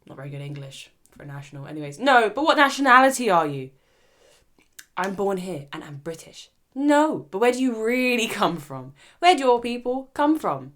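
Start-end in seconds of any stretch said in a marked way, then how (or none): 2.0-10.7 louder, higher insistent questioning; a mock pushy lady asking again; the lady pressing. Host voicing the lady pushing where she's from, louder.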